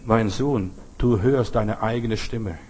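low, slow, gentle explanation; smiling father. Acted. Father tells his son about the echo, calm low voice. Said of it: "Der Vater lächelt".

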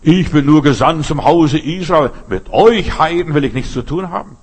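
loud, stern dismissal of the woman; stern rebuke. Retold or imitated. Jesus turning the foreign woman away, loud and stern. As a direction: boom it out as a harsh refusal. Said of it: "hat Jesus gesagt".

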